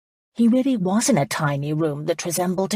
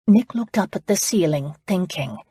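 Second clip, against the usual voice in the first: plain reading voice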